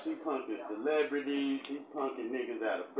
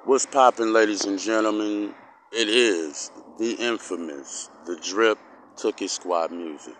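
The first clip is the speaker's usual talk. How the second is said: lower, loud hype host voice; vlog host intro; 7 semitones lower, 6 dB louder than usual. Acted. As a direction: greet the audience like a show host.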